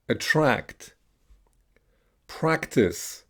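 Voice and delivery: "Attract" with short A as in pan; "practice" said alone with short A, falling tune; short A modelled. Shown as a demonstration. Teacher has learners repeat short A words, careful drill delivery. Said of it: "So here's gonna be the A".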